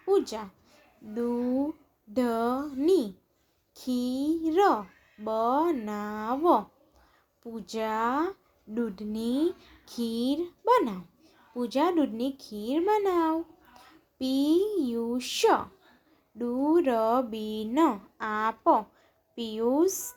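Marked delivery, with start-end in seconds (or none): 1.0-6.6 દૂધની ખીર બનાવ very slow, each syllable stretched; drawn-out દૂધની ખીર બનાવ, syllables separated. Shown as a demonstration. Syllable-by-syllable reading, then blended words. 14.2-18.7 Piyush, દૂરબીન આપ slow, each syllable held; drawn-out Piyush, દૂરબીન આપ, syllables separated. Shown as a demonstration. Syllable-by-syllable reading, then blended words.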